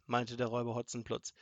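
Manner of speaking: plain reading voice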